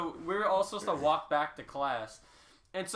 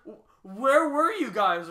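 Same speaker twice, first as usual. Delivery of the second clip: puzzled teacher; 7 semitones higher, 9 dB louder, much slower than usual. Retold or imitated. Teacher searching for hiding class.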